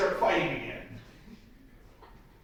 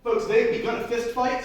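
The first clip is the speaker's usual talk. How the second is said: ordinary delivery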